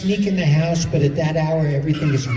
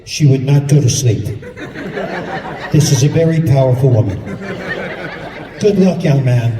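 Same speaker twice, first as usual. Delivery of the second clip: voice of God; 5 semitones lower than usual. Retold or imitated. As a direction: boom it like God from above. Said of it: "I heard this voice say".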